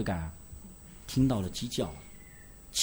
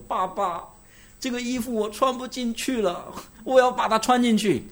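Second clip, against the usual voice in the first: higher, slow, a small son whimpering; whining child; 9 semitones higher than usual. Retold or imitated. Father retells his son whining to him, high and slow. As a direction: play the little boy pleading over his clothes. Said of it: "哭着找倩文姊妹或者找我说".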